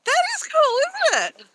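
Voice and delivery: high-pitched filter voice; squeaky chipmunk app voice. Real, not acted. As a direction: voice changed by a phone filter.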